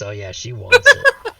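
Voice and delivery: imitating a creepy voice, loud and far-off; a hammed-up creepy whisper. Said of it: "with that creepy voice".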